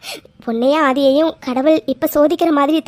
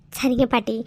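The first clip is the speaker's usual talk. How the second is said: timid quiet ask; 3 semitones lower, 8 dB quieter than usual. Acted. Granddaughter timidly asks grandma.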